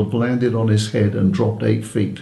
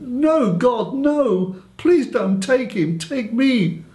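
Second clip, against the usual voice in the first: retold scream; 9 semitones higher, slower than usual. Grandparent begging God to spare the grandson, retold scream. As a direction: plead to God in a restrained, high-pitched cry. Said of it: "I screamed".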